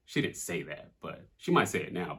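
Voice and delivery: normal talking voice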